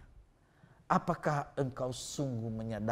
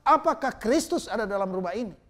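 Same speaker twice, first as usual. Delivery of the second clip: louder, higher firm question; firm, clear question; 8 semitones higher, 7 dB louder than usual. Retold or imitated. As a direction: ask it firmly and plainly, like the pastor. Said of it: "kata pendeta itu begitu tegas, jelas". (pertanyaan tegas dan jelas, lebih keras)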